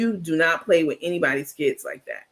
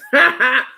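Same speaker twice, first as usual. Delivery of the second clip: a sudden loud laugh bursting out; loud burst of laughter; 7 semitones higher, 8 dB louder than usual. Real, not acted. Streamer reacting to chat, loud laugh.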